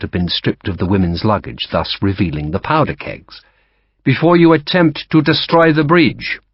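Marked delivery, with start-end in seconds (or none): 4.0-6.4 polite French officer, higher and louder; courteous French-accented captain. Acted. French captain to Sharp, polite French accent. Lacroix voiced.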